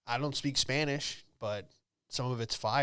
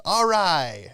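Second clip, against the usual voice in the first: higher, loud deflated all right; resigned magician; 6 semitones higher, 9 dB louder than usual. Retold or imitated. Magician giving up on the crowd, higher and loud, resigned. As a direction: say it like a beaten magician.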